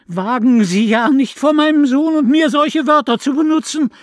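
high, loud outraged scolding; mother shouting at the neighbour; furious shout. A narrator's reading. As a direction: shout her down. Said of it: "Meine Mutter schrie auch sie an".

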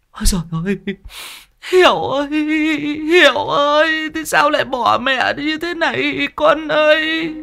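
very high, keening lament; heartbroken wailing. A narrator's reading. Bà Cầm wailing over her son Hiểu's body, heartbroken wailing. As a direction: wail over your dead son. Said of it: "Bà òa lên, khóc như xé ruột xé gan".

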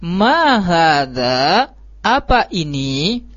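"Ma hadza, apa ini" as a drawn-out question, "ini" rising at the end; intonacja pytająca. Shown as a demonstration. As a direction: stretch "Ma hadza" slowly and lift "ini" into a question. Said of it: "intonasinya juga kita harus sesuaikan".

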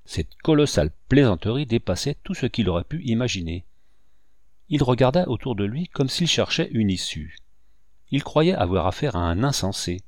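ordinary delivery, nothing marked

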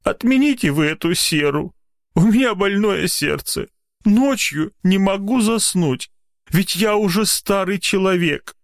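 high, tearful pleading; whiny. A narrator's reading. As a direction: beg pitifully, pitch held high. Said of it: "плаксивым голосом".

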